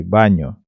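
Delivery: "Banho" with the final "nho" fully sounded; clear "nho" ending. Shown as a demonstration. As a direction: finish "Banho" on a distinct nyo. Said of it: "Tem que pronunciar esse nho sempre". ("Banho" pronunciando bem o nho)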